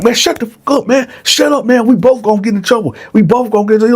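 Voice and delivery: high, fast, furious hushing; angry hushing. Retold or imitated. Bone silencing Dylan, fast angry hushed threat. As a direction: shut him up fast and angry. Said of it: "you hear Bone like".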